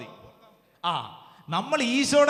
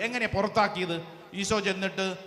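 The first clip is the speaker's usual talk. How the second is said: ordinary talk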